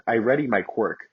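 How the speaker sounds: plain reading voice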